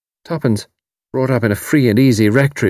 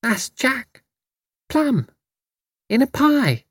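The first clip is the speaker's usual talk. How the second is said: put-on murmuring toddler; slower than usual. A narrator's reading. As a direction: murmur each word like a small child. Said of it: "Betty murmuring".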